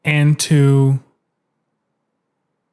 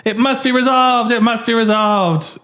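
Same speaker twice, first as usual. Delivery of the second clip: slow, higher mock-solemn insistence; mock insistent caller; 6 semitones higher, slower than usual. News callers demand the mystery be solved, mock solemn. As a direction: insist gravely, a touch hammy. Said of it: "they're like".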